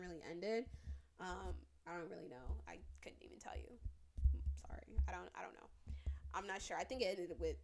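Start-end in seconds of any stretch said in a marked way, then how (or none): 2.7-3.8 hushed unsure admission; real whisper, unsure; whispered aside. Real, not acted. Trailing off unsure. 5.1-5.7 hushed unsure admission; real whisper, unsure; whispered aside. Real, not acted. Trailing off unsure.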